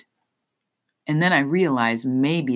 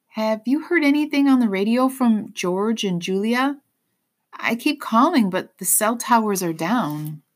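higher, anxious questioning voice; trembling voice; 8 semitones higher than usual. A narrator's reading. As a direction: ask it with worry, pitched up. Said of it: "voice trembling".

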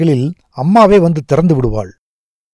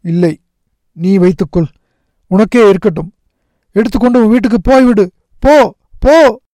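rising to a high, loud cry; insist, building to a pained yell; tearful shouting; 7 semitones higher, 3 dB louder, faster than usual. A narrator's reading. Rajappa orders his friend home, crying out louder and higher. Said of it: "அழுது கொண்டே கத்தினான்".

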